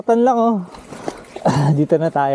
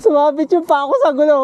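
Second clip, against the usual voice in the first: laughing; 10 semitones higher, faster than usual. Real, not acted. Laughing retelling of snag.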